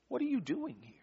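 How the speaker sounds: hushed, high-lifted soft question; very soft voice, lifted high like a gentle whisper; low whisper. Retold or imitated. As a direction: ask it barely audibly. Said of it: "a sound of a low whisper".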